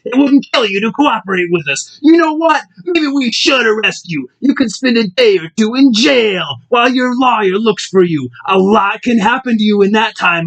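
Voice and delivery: hulked-out rage. Acted. Booger threatening Marcus in the car, angry snarl. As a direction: lean in and threaten him. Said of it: "all hulked out and throbbing veins".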